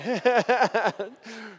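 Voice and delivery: light amused chuckle; real laugh. Real, not acted. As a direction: laugh it off with the room. Laughs after joke.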